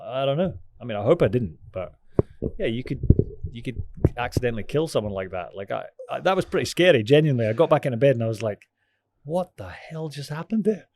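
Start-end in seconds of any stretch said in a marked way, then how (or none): none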